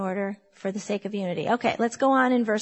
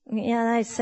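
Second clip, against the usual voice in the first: usual speaking voice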